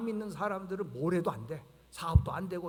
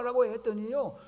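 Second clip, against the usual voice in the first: ordinary delivery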